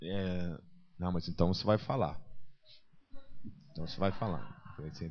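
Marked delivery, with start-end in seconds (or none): none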